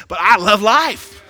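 loud, drawn-out joyful cry; emotional shout. Real, not acted. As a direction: burst out with love of life. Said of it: "Excuse me when I get emotional".

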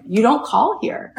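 high-pitched imitated telling-off; scolding rebuff. Spielberg's office woman rebuffing caller, high scolding. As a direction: play an affronted gatekeeper.

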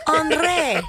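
put-on high French-accented "Henri"; French lady. Cohost playing little French lady, exaggerated French accent. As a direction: play up the French accent, on-RAY. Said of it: "a little French lady trying to say Henri".

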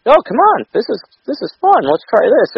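put-on high, bouncy kids' show host voice; overexcited children's TV presenter; Blippi. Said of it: "and he's like".

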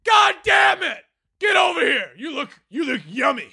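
high, wild, shouting voice of a man who snapped; crazed cannibal yell. Retold or imitated. COO mocked as a screaming man-eater, high crazed shout. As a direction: shriek it like a madman craving flesh. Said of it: "he's like".

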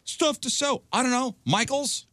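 high put-on voice of a friend's mother; Ben's mom. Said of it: "So Ben's mom was like".

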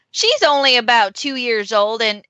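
folksy Southern seller; Southern accent. Acted. Seller describes her young pony to buyers, Southern accent. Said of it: "it has to be Southern".

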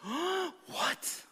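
high shocked cry; shocked what. Acted. Onlookers seeing Jesus touch a leper, high shocked cry. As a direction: squeak it out in disbelief.

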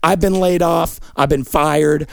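usual speaking voice

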